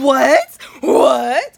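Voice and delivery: loud, high rising "what?"; hammed-up loud astonishment; surprised. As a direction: blurt it in mock disbelief. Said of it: "I was like, surprised".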